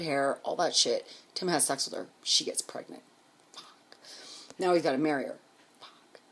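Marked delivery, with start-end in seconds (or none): 3.5-4.0 a breathy whispered fuck under her breath; whispered curse. Retold or imitated. Whispering fuck to herself about the pregnancy. Reacting to ex's pregnant girlfriend. 5.8-6.2 a breathy whispered fuck under her breath; whispered curse. Retold or imitated. Whispering fuck to herself about the pregnancy. Reacting to ex having to marry.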